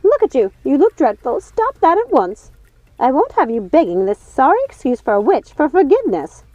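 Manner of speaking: scorn them from above; vexed scolding. A narrator's reading. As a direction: Lucinda scolding the sisters. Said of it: "looked extremely vexed".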